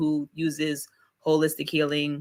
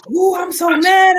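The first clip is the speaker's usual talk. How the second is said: much higher, louder, slow hammed-up fuming; mock fury; 11 dB louder, slower than usual. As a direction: ham up a fake tantrum. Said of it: "You're just like".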